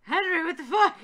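high, loud, half-laughing yell; appalled shout. Acted. Hyde appalled at Henry's plan, loud outraged yell. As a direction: shriek it in mock outrage.

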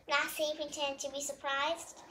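plain reading voice

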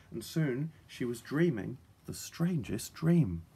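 usual speaking voice

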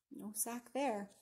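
usual speaking voice

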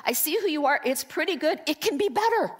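coaxing them up a notch; God's gentle correction. Retold or imitated.

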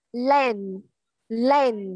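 LANE said short and quick; quick reading. Shown as a demonstration. Teacher showing how it sounds read fast, LANE said quickly. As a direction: say LANE fast, without stretching the vowel. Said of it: "Mình đọc nhanh sẽ giống như".

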